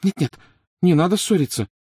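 high-pitched, worried appeal; anxious pleading. A narrator's reading. Hermione stepping between the quarrelling boys, pleading.

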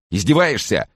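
high, loud indignant outburst; outraged cry. A narrator's reading. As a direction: cry out, offended. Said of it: "вскрикнул он".

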